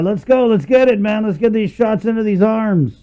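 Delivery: rally the troops like a drill sergeant. Real, not acted. Rallying cry at the show's end.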